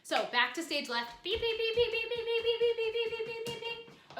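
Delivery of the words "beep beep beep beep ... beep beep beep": high, loud monotone beeps; long string of high, even beeps; beeping noise. Shown as a demonstration. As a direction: beep like a reversing truck, high and steady. Imitates beeping while moving backwards.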